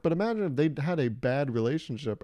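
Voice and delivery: ordinary delivery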